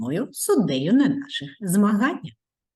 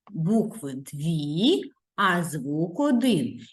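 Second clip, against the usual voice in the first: slow rule; much slower than usual. Shown as a demonstration. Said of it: "teacher stresses the rule".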